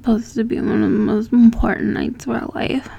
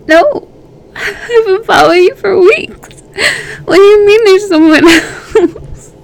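higher, louder, disbelieving accusation; jealous outburst; 6 semitones higher, 5 dB louder than usual. Acted. Jealous lover confronting partner about another woman, loud and shrill. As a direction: confront him, voice climbing in jealousy.

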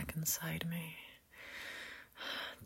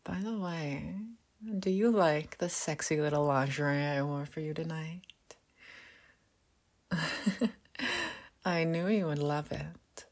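sultry whisper. Acted. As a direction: whisper it close and teasing. Seductive whispered roleplay throughout.

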